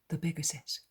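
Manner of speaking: usual speaking voice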